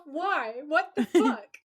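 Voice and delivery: laughing in disbelief; exasperated laughing outburst. Retold or imitated. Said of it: "I said to myself".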